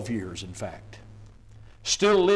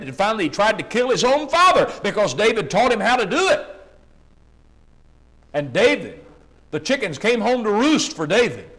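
rising, louder, higher fiery sermon climb; preaching at full force; 6 semitones higher, 7 dB louder than usual. Acted. Preacher to his congregation, thundering sermon. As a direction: build the fire with every line.

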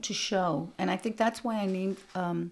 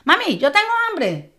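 put-on eager child; 10 semitones higher, 10 dB louder than usual. Child asking mother, loud.

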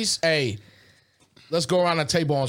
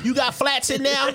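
raised, eager voice; imitating her again; 6 semitones higher than usual. Retelling his date's question a second time, lightly voiced. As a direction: repeat her wide-eyed question. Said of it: "That's how she said it".

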